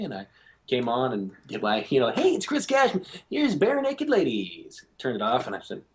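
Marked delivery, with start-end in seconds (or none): 2.1-4.8 high-pitched DJ song introduction, last line drawn out; radio DJ intro. Retold or imitated. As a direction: introduce the band like an upbeat radio host. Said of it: "I was introducing songs like this".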